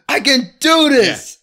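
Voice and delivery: a high, loud, slow yell to psych himself up; pumped-up self pep yell. Retold or imitated. Alone in the bathroom before an open mic, a pumped-up high yell. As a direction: bellow it at yourself in the mirror.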